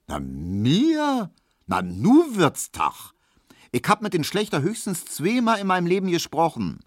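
high-pitched, affronted protest; scandalised maid, voice rising; indignant denial. A narrator's reading. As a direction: protest as if the idea were absurd. Maid indignantly denies fancying the butcher.

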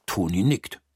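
plain reading voice

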